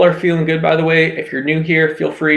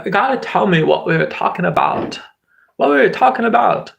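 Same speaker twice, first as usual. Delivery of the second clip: goofy made-up voice climbing much higher; odd put-on voice, rising; 6 semitones higher than usual. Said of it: "I don't know what that voice is".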